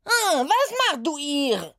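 hohe, langsame, barsche Putzfrauenstimme; barsch. Acted. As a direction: fahr das Mädchen barsch an. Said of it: "fuhr sie eine Stimme barsch an". (Putzfrau fährt Oda barsch an, hoch und langsam)

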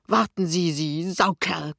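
strained, angry gasp of an insult; breathless threat; panting. A narrator's reading. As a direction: wheeze it mid-scuffle, drawn out at the end. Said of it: "keucht Pinneberg".

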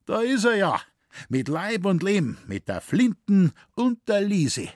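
high, loud, delighted greeting; glad call. Acted. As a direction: call out with joy as the son walks in. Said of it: "rief erfreut". (freudig und laut gerufen)

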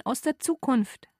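ordinary delivery, nothing marked

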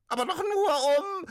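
very high, loud squeaky ghost cry; pitiful little ghost. Acted. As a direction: wail it like a tiny trapped ghost. Ghost interrupted.

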